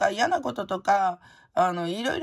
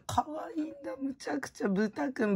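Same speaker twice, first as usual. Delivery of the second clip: cooing softly; 7 semitones higher, 4 dB quieter than usual. Real, not acted. Gushing over pigs.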